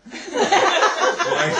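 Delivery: high, loud laughing squeal. Real, not acted. Laughing about spit flying.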